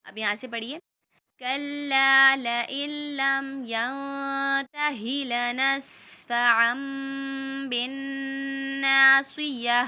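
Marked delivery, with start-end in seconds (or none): 1.4-9.8 slow, measured tajweed recitation; Quran recitation. Shown as a demonstration. Teacher reciting the verse slowly for children to follow. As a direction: recite each word slowly, vowels held long.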